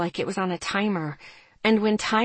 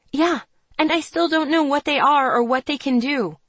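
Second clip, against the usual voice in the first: higher, heated retort; shooting back; 7 semitones higher, 4 dB louder than usual. A narrator's reading. Narrator defending herself to Serena, frustrated retort. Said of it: "I shot back".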